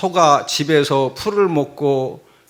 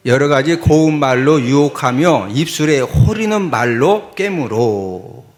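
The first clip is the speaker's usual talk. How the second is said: low, measured verse reading; scripture reading; 5 semitones lower than usual. Real, not acted. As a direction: read it out firmly, stretching the last word. Reads verse with congregation.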